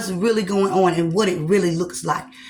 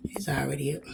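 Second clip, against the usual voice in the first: whispered aside; 3 semitones lower, 9 dB quieter, faster than usual. Real, not acted. Muttered repeat to herself.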